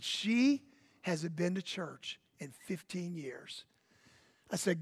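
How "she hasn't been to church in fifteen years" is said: hushed aside. Retold or imitated. Pastor's private aside about her.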